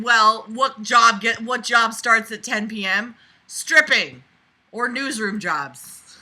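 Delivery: loud, slow sarcastic shout; mock the character's logic at full volume; mocking inner voice. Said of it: "you're gonna correlate in your head".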